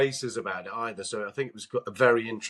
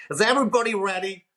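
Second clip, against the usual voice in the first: high, loud "Is everybody ready?" called into the room; the publicist announces the star, brisk and loud; imitating a bustling publicist; 9 semitones higher, 5 dB louder, faster than usual. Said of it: "publicist will pop their head round the door first, going".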